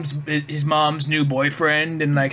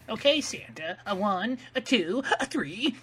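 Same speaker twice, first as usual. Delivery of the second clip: higher, softer count-in; soft, pitched-up bandleader counting off; imitating a changed voice; 4 semitones higher, 6 dB quieter, slower than usual. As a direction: lightly mimic a sweet count-in. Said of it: "notice how his voice changes".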